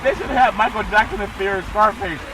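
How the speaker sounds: ordinary delivery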